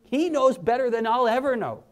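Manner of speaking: ordinary talk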